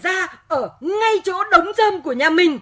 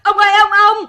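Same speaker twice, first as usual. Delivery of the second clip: urgent call; 2 semitones higher, 8 dB louder than usual. Acted. Girl calls her grandfather.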